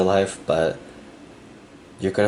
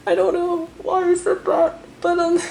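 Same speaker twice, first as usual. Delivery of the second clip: choked up, fighting back tears; real crying. Real, not acted. Reading cards for a viewer, breaking into real tears. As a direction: voice cracking high through real tears. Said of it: "oh God, I'm crying".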